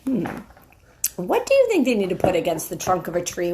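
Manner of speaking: plain reading voice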